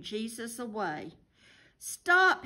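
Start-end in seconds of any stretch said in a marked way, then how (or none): 1.8-2.4 high, loud, urgent cry; cried out. A narrator's reading. Boy to soldiers taking his friend away, crying out. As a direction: shout it out in alarm. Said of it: "he cried".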